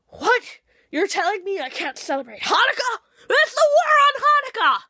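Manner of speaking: gasp, then a high, loud shocked cry; hammed-up indignant gasp and shriek; mock outrage. Mocking people offended by happy holidays, shrill outrage. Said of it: "it's not like".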